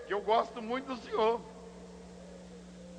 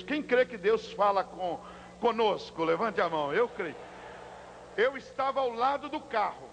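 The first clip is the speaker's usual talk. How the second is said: ordinary delivery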